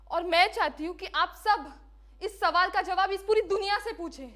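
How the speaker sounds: high, loud accusing questions; impassioned challenge. Acted. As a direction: hurl the question at the world. Condemned woman challenges the world. (ऊँची आवाज़ में जोशीला सवाल)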